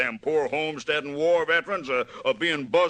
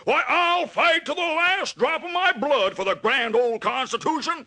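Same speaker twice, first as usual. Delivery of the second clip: high, grandstanding oration; high flag-waving campaign oratory; pompous bluster; 11 semitones higher than usual. Acted. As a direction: orate it like a stump speech. Villain's patriotic bluster.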